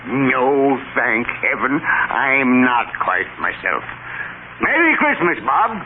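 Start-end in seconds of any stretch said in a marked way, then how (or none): 4.6-5.8 high, bright and jubilant; joyful. Acted. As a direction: burst with Christmas joy. Joyful reformed Scrooge.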